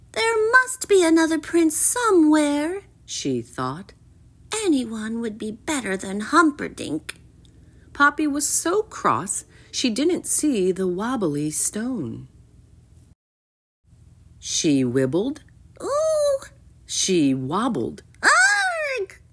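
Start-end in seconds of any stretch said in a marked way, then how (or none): 0.1-7.2 high princess voice, grumbling; pitched-up, exasperated musing; headstrong princess, cross. Acted. 15.8-16.4 very high wobbling "ooh"; high-pitched little whoop; startled ooh. A narrator's reading. Princess wobbles on a stone. 18.2-19.2 very high cry of alarm; acted high-pitched scream; falling shriek. A narrator's reading. Princess falls into pond.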